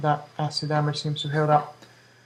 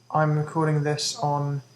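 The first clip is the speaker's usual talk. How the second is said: usual speaking voice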